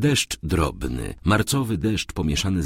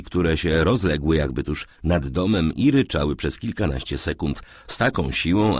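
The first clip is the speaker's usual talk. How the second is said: usual speaking voice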